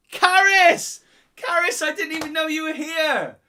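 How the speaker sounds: high, loud squeal of greeting; overjoyed high-pitched shout; delighted surprise. Real, not acted. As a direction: cry out, thrilled to discover a friend is here. Surprised greeting to someone watching.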